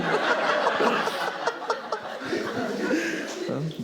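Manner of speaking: long high airy laugh. Real, not acted. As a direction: collapse into silent laughter.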